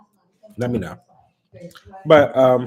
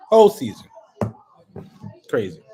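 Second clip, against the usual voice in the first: exasperated; 2 semitones higher, 6 dB louder, faster than usual. Real, not acted. Fan rants about a rigged season, loud exasperated shout. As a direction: throw it out in outrage. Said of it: "ranting about the season".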